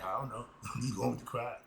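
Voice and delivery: normal talking voice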